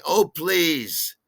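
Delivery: loud, high-pitched scoff; exasperated. Retold or imitated. Irritated at friend's histrionics.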